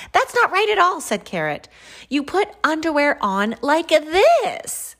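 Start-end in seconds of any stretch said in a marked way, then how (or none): 0.1-1.0 high, loud storybook protest; exasperated correction. Acted. Carrot corrects them a third time. 2.1-5.0 high, loud and drawn out; showing off how. Acted. Carrot demonstrates to the others.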